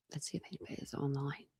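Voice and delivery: fast unvoiced whisper. Real, not acted. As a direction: whisper it to yourself. Whispers to herself reading chat.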